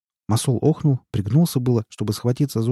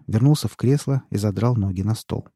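ordinary delivery

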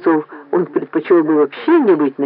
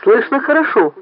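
higher, mildly impatient reply; 6 semitones higher than usual. A narrator's reading. Director answering an emergency call, mildly impatient. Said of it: "нетерпеливо сказал Поль".

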